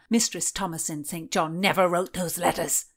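loud, furious scolding man; jealous lover shouting her down; angry berating. Acted.

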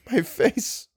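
high, cracking and hushed; voice breaking. Acted. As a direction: let the words break on face. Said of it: "Ullman's voice broke".

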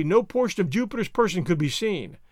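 plain reading voice

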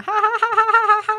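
high, loud mocking guffaw of her date; cackling at his own bad joke about his ex; mock goofy laugh. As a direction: ham up his tactless laugh.

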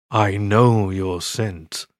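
slow, deep monster voice, every word weighed; deep imposing voice. Acted. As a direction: loom over him with a slow, imposing rumble. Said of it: "a deep, imposing voice said".

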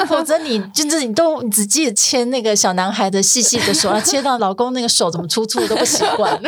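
tease, breaking into laughter; laughing. Real, not acted. Guest jokes to the hosts about forgetting husbands, laughing.

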